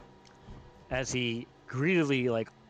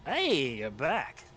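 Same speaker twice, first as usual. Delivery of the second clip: tavern keeper Happy; 2 semitones higher, much slower than usual. Acted. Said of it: "he goes".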